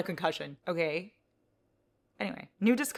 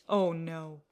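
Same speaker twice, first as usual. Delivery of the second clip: ordinary talk, nothing marked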